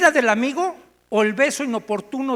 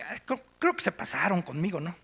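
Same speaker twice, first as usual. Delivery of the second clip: timid murmur; 6 semitones lower, 9 dB quieter than usual. Retold or imitated. Timid self-talk, hurt.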